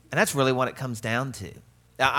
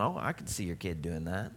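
muttered aside; 6 semitones lower, 8 dB quieter than usual. Retold or imitated. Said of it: "I'm sitting there going".

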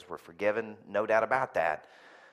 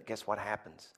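normal talking voice

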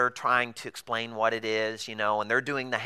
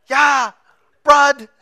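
high, loud bright "Yeah, blood."; loud imitation of happy confirmation; mock cheerful yes; 13 semitones higher, 12 dB louder than usual. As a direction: play the hosts nodding eagerly.

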